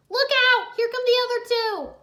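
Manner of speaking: high, loud alarmed warning; warning shout. Acted. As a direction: yell a warning. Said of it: "Jay shouted".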